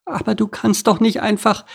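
high, squeaky protest; small creatures objecting in a high voice; protesting ants. Acted. Ants object to Superbert's building, protesting.

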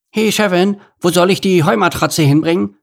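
busy little meerkat shouting a question; meerkat worker calling. A narrator's reading. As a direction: call across to the boss.

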